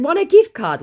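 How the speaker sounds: squeaky mock offer; mocking taunt. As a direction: tease him like a child. Taunting scammer.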